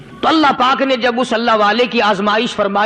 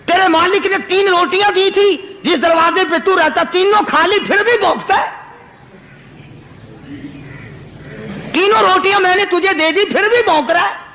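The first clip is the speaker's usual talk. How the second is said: higher-pitched, indignant scolding; acted outrage, pitched up; angry saint; 6 semitones higher than usual. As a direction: scold an ungrateful creature. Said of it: "غصے میں آ گیا".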